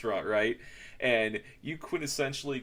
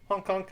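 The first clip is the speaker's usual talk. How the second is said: goose honks; 9 semitones higher than usual. Retold or imitated. Imitating the goose.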